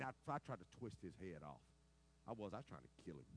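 normal talking voice

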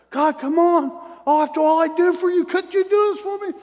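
whining at God like you've been cheated; loud complaint to God. Retold or imitated. Preacher retells his prayer to God, high, loud and aggrieved. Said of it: "And I said".

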